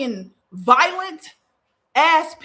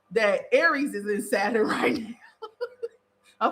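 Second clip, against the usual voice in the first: laughing; 2 semitones lower, much slower than usual. Real, not acted. Laughs at own excuse.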